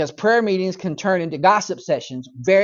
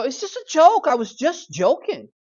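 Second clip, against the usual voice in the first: defensive excuse; 8 semitones higher than usual. Acted. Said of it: "you go".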